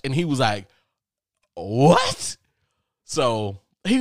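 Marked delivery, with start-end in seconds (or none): 1.5-2.4 loud, high "what"; acted-out shocked roommate; astonished outburst. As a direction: blurt it out in disbelief.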